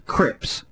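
crips said for crisps, lightly mocked, unvoiced; imitated crips. Caller's relative's odd version of crisps, said as crips. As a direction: clip the word crips without the middle s. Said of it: "a relative pronounces crisps".

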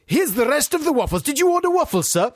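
high, chirpy waiter. Acted. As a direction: serve it like an eager waiter. Playing a waiter.

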